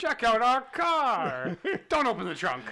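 high, loud, hammed-up holler; mock shouting. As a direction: yell it out as a joking warning. Acted warning shouts in a joke.

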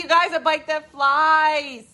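slow, loud, high-pitched exclamation; hammed-up desperate amazement; frantic pleading. Recalls pleading with unimpressed kids.

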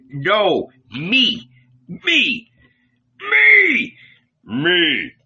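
rival voices, leaping in pitch; clamoring shouts. A narrator's reading. Disciples squabbling over who is loved most, shouting. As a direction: shout over each other to be chosen. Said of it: "They all dashed forward and shouted".

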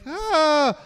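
high startled yelp. Retold or imitated. Goofball confronted by bouncer, high startled yelp. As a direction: yelp in sudden alarm. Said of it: "he's like".

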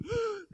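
a sharp, high startled gasp; mock gasp. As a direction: gasp like a panicked god. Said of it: "God does not up in heaven going".

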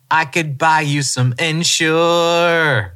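slow, drawn-out teasing jab; mock Miley taunt. Miley mocking her older dad, slow and teasing. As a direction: needle him slowly about his age. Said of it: "Miley's like".

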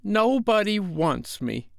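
put-on high, slow, drawn-out little boy; hurt child on the verge of tears; pleading small child, pitched high and slowed; nearly crying. A narrator's reading. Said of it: "Michael had nearly cried".

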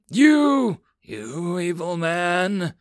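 put-on high, slow girlish accusation; cute girl mage. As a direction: drag out the blame like a wronged little girl. Girl mage scolds Hitsu again.

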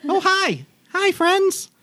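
very high, loud chirpy gnome voice; a tiny excited gnome says hello; squeaky gnome greeting. Acted. Gnome pigeon handler greets the party, high and chirpy.

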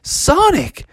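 high, drawn-out amazement; delighted Froggy. Acted. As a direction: marvel at finally managing it. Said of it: "and he was like".

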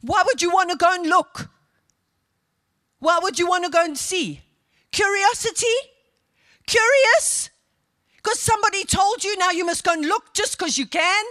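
emphatic, exhorting pulpit voice; forceful preaching. Real, not acted. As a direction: press the congregation with insistent questions.

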